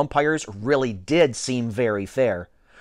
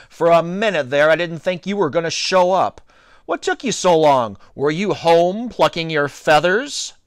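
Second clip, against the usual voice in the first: louder, higher jeer; taunting shout; 5 semitones higher, 4 dB louder than usual. A narrator's reading. T J jeering at late-arriving Alex, shouted. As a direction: call it across the field as a taunt. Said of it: "shouted T J when he saw me coming".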